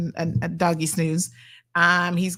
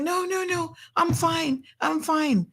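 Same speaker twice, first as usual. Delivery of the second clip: put-on tearful child insisting; 10 semitones higher, slower than usual. Daughter insists she's fine to stay for brownies, high and pleading. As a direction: plead like a teary kid who won't leave. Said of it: "she's like".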